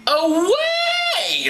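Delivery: very high, stretched cry; mock crowd shout. Crowd rejecting Paul, hammed-up shouting. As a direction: lift the voice in a long yell. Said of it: "they lifted up their voices".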